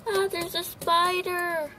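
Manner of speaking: gasp, then a high, hammed-up cry; mock gasp of fright. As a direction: act spooked all over again. Pretend panic over toy spider.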